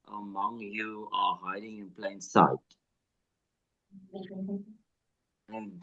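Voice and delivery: choppy call audio dropping out; breaking up. Real, not acted. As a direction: voice cutting in and out on a bad line. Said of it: "You're breaking up quite a bit".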